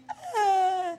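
comic imitation of sudden tears; imitated crying. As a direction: burst into hammed-up sobbing. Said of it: "Biglang umiyak".

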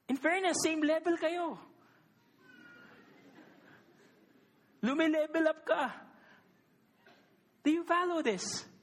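high, impassioned sermon voice; fervent preaching. Acted. Preacher to the congregation, rising into impassioned preaching. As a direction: drive the point home to the congregation. Preacher still at full force.

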